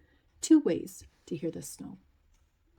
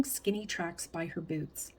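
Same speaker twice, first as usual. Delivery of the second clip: usual speaking voice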